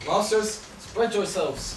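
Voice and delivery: loud call to the company. Acted. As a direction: summon the players loudly. Bottom calling actors to order.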